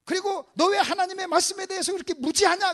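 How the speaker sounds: loud scolding questions; acted rebuke. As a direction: demand an answer from the pupils. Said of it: "뭐라고 책망하냐면".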